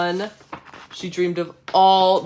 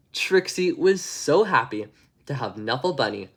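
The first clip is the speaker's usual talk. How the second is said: plain reading voice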